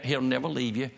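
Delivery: ordinary talk, nothing marked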